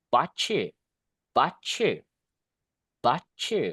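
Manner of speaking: 'baca' said as 'bachu', T and Y merged into ch; assimilated ch. Shown as a demonstration. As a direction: blend 'baca' into ch sound. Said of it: "dirubah suara kayak C". ('baca' diucapkan 'bachu' dengan suara C)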